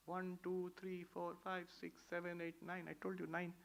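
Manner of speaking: soft, muttered counting to himself; counting under breath. Retold or imitated. Friend anxiously counting the group under his breath. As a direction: count quietly, half to yourself.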